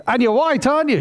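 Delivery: very high, pressing mum; exasperated mother bear. Acted. Mother bear to her cub, very high and exasperated. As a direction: push the cub for a yes.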